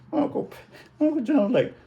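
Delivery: high, laughing through the words; can't stop laughing; giggling as the story goes on. Real, not acted. Laughing while telling.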